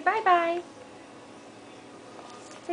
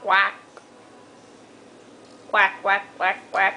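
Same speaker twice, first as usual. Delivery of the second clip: duck quack; 7 semitones lower than usual. Shown as a demonstration. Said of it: "What sound does the duck make?".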